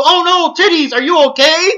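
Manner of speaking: high imitated cartoon hero; Goku. Cartoon hero checking on his wife, high mock worry.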